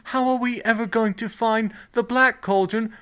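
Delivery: high, whiny mock hero panic; mock panicking hero. Podcaster mocks the hero among countless cauldrons, high mock whine.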